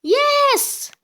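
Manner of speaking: loud high victory shout; triumphant cheer. Real, not acted. Gamer cheering a won prize, loud and high. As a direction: yell it like you just won.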